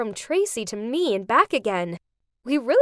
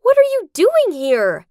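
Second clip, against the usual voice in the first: loud, high shocked cry; startled scream; 8 semitones higher, 8 dB louder than usual. Acted. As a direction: shout it in fright at the intruder. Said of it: "I almost screamed".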